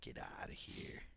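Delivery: hushed, voiceless aside; under the breath, barely there; whispered disbelief. Real, not acted. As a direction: whisper it in awed dismissal. Dismissive aside after praising attack.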